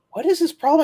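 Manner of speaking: high exasperated question; indignant question. Retold or imitated. Supervisor listening in, high indignant question. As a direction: sound fed up. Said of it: "She goes".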